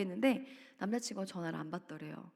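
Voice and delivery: ordinary talk, nothing marked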